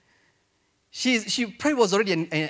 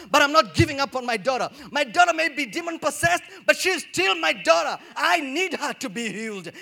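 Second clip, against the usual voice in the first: impassioned plea; 6 semitones higher than usual. Retold or imitated. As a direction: plead with rising fervor. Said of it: "It's like".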